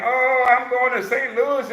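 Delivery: high mock-eager traveller voice; boastful would-be traveller. Coach mimics clients talking about the train, high mock boasting. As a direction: mimic big talk, pitched well up. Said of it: "You'll talk about that train".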